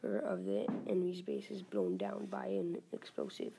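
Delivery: usual speaking voice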